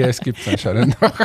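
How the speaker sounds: ordinary talk, nothing marked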